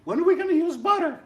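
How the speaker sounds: mock the nagging student in a squeaky high whine; mock whiny student. Teacher mimics a student's email question in a squeaky whine. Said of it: "You keep sending me an email saying".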